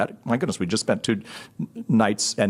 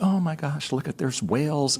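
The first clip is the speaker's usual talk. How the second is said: imitated excitement; 5 semitones higher than usual. Wife spotting whales on a coastal drive, excited. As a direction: lightly mimic a delighted sightseer.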